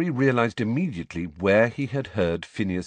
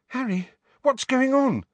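frightened. A narrator's reading. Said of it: "who looked frightened".

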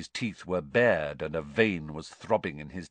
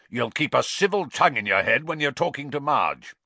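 growled; 6 semitones higher, 5 dB louder than usual. A narrator's reading. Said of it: "growled Uncle Vernon".

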